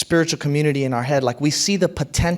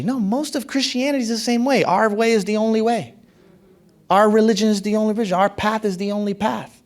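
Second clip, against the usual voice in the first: higher, mock-righteous chant of only; mocking dogmatism; 7 semitones higher than usual. Pastor parodying exclusive religion, mock smug. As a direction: parody the know-it-all believer.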